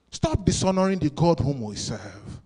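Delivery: ordinary delivery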